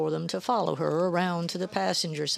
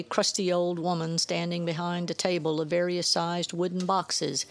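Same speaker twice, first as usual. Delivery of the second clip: ordinary delivery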